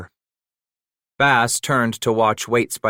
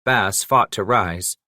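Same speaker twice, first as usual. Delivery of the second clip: usual speaking voice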